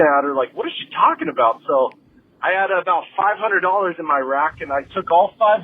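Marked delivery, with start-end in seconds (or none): 0.5-1.6 baffled. Retold or imitated. Baffled look at rude lady.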